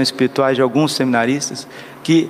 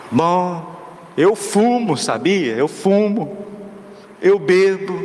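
homeless man confessing; 3 semitones higher, slower than usual. Retold or imitated.

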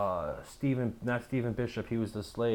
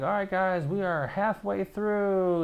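mock tour guide; 10 semitones higher, slower than usual. Imitating tour guides rallying the group, high and chirpy. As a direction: mimic a peppy tour guide calling the group.

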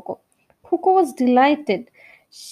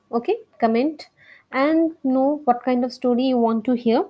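ordinary delivery, nothing marked

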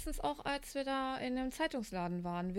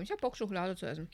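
chewing chocolate while talking; words round a bite of chocolate; mouth full; 6 semitones lower, faster than usual. Real, not acted. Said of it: "ich esse grad Schokolade".

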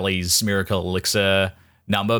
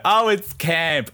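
higher, slow mock gush; mock gushing fan; 9 semitones higher, slower than usual. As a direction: drawl out the praise like a smug fan.

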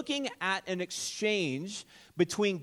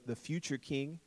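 normal talking voice

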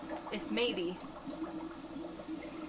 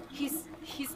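horrified whisper; 8 dB quieter than usual. Acted. Dex finds Mike killed.

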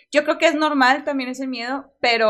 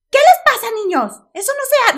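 much higher and louder scolding; scolding mother; 13 semitones higher, 9 dB louder than usual. Retold or imitated. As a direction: mom telling off her kids. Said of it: "nos empieza a regañar".

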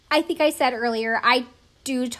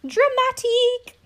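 dramatic pitched much higher and slow; stress on dramatic; 14 semitones higher, 4 dB louder, slower than usual. Real, not acted. Vlogger mocking the scene, high, stretched dramatic. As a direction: sing out dramatic in an exaggerated swoop.

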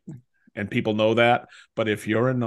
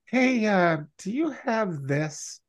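mooching neighbor; 8 semitones higher, slower than usual. Retold or imitated. Neighbor voiced asking to borrow.

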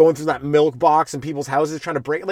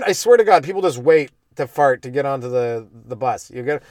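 ordinary talk, nothing marked

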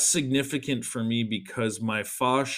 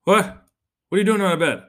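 louder annoyed dad; stern dad; 8 semitones higher, 8 dB louder, much faster than usual. Retold or imitated. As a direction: bark it like an interrupted father. Dad interrupted watching news.

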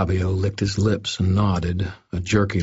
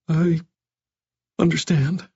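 small, high, halting voice; very meek; 10 semitones higher than usual. A narrator's reading. Scolded doctor submits to Harry, very meek. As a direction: give in meekly, pitched higher. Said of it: "he said in a very meek voice".

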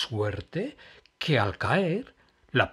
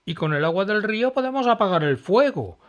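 eager crocodile; 8 semitones higher, 6 dB louder than usual. Acted. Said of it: "dijo Pillo el cocodrilo".

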